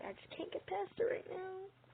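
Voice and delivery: soft whimpering complaint; whiny fake cry. Real, not acted. Frustrated, voice cracking to cry.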